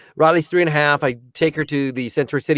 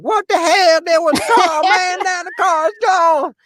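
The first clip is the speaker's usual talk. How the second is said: a hammed-up Richard Pryor bit, much higher; shitty Richard Pryor; 12 semitones higher than usual. Dad panicking as the parked car vanishes, doing a bad Richard Pryor. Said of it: "just doing a really shitty Richard Pryor".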